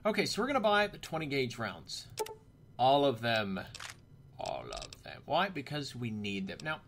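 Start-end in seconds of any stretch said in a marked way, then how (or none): 2.8-3.6 loud, firm declaration; emphatic. Pretend. Gamer buying all the shotgun rounds, loud and emphatic. Emphatic purchase, then echoed low. 4.4-5.2 very low, softer voice; mock-dramatic echo. Gamer echoing his big purchase, low mock-dramatic voice.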